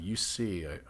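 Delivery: normal talking voice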